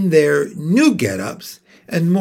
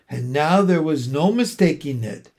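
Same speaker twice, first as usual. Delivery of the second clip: ordinary delivery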